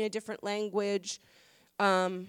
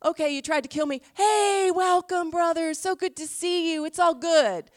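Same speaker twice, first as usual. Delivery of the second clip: bright, loud, gushing welcome, pitched higher; mock cheery forgiver; 5 semitones higher, 6 dB louder than usual. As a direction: overdo the all-is-forgiven welcome. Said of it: "Don't you think Christians are supposed to be".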